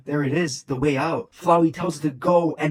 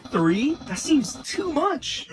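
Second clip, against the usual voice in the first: much higher, slow outraged squeak; Papyrus shouting; 12 semitones higher, much slower than usual. Acted. Papyrus shouts at Sans about the freebies. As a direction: protest the number in disbelief. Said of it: "Papyrus shouts".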